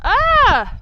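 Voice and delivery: high, loud gasp of delight; loud happy exclamation. Real, not acted. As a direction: cry out at the view. Exclamation at pretty view.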